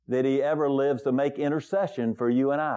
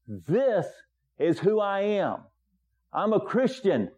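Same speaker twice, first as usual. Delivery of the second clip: firm, raised declaration of faith; emphatic; 5 semitones higher than usual. Retold or imitated. Manager telling the pastor who he is, emphatic. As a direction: retell it with the manager's conviction. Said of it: "And he was very emphatic about that".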